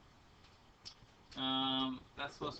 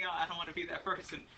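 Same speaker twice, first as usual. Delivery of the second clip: ordinary delivery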